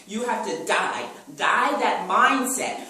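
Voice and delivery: slow, loud, hammered words; forceful command. Acted. Preacher commands the church to let go, slow and loud. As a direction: slam each word down like a verdict. Climax of sermon exhortation.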